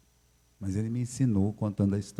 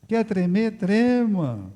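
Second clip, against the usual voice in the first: lightly voiced pastor, higher; mock pastor; 10 semitones higher, much slower than usual. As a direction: toss the advice back with amusement. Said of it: "ele olhou pra mim".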